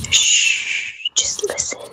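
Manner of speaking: hushed shush; whispering. Real, not acted. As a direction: whisper it into the mic. Hushing for ASMR joke.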